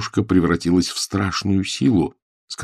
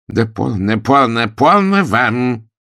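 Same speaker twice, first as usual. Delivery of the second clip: louder, slow, scolding; sharp rebuke; 5 semitones higher, 5 dB louder, slower than usual. A narrator's reading. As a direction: snap at the children to stop. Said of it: "прикрикнула на них мисс Вилкис". (окрик)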